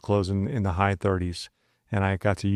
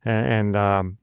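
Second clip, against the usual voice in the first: normal talking voice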